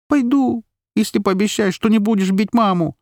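put-on very high child's voice; scared little girl. A narrator's reading. Girl bargaining to protect her mother, high pleading voice. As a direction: plead as a small frightened girl.

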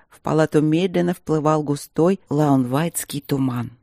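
ordinary delivery, nothing marked

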